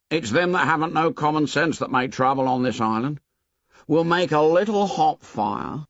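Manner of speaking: a hint of Piggy holding forth; Piggy voiced. A narrator's reading. Piggy lecturing Ralph about trouble, lightly voiced.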